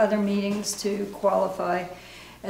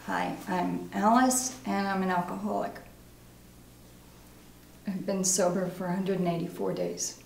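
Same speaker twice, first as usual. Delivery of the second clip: subdued, soft confession at a meeting; recovering alcoholic; 8 dB quieter than usual. Acted. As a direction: share it quietly as a recovering alcoholic. Acting exercise, recovery meeting confession.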